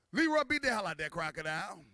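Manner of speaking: ordinary talk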